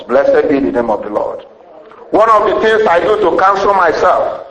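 normal talking voice

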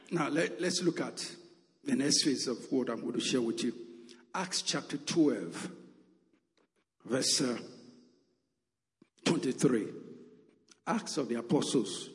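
usual speaking voice

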